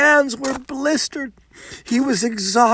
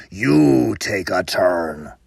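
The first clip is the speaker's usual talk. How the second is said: huge brute; 12 semitones lower, slower than usual. Acted. Huge man orders, deep voice.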